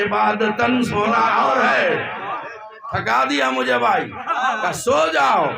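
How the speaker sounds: high, loud, sung-out sermon building in fervour; fervent majlis recitation, half chanting; preaching at full force. Acted. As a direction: preach it out to the gathering, rising. Zakir's majlis rising to full force.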